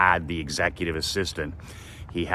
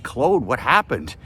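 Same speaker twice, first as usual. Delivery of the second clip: high and slow, puzzled; baffled question; 6 semitones higher than usual. Retold or imitated. Asking his friend Claude about his firing, slow and disbelieving. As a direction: drag it out in disbelief to your friend. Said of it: "And I'm like".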